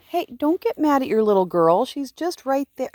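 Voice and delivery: high pleading coo to the ewe. Real, not acted.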